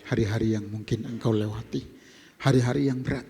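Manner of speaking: low, gentle closing exhortation over music. Acted. Pastor comforting the congregation over worship music, low and gentle.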